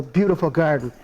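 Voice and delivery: plain reading voice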